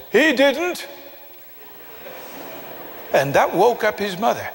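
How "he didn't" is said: high-pitched mock yell; retold boy's shout. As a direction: imitate a boy piping up indignantly. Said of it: "shouted at the top of his voice".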